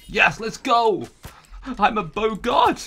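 slow, loud, high victory shout; triumphant cheer. Real, not acted. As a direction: celebrate the kill. Bow kill triumph.

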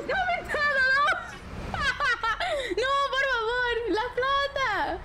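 high voice breaking into laughter; laughing. Pretend. Laughing while playing the money collector. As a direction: laugh through the mock threat.

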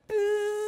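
empty the lungs in one steady buzz; bee breath. Shown as a demonstration. Teacher repeats the bee breath with children, long buzz. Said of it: "Let's do it one more time".

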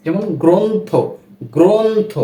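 গ্রন্থ said as "gronth", o after g; গ্রন্থ with a clear o-sound; model reading. Shown as a demonstration. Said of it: "ওকারান্তে উচ্চারণ". (গ্রন্থ শব্দে ও-কারান্ত উচ্চারণ)

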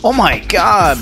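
usual speaking voice

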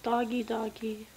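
soft unvoiced coaxing of a dog; whispering to dog. Real, not acted. Whispering to the dog up on the shelf. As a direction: call the doggy in a hush.

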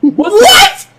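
high loud shouting. Acted. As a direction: belt out the catchphrase. Shouted catchphrase bit.

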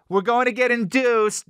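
high, proud mock wife voice; wife bragging about the induction, mimicked high and proud. Mocking wife's bragging.

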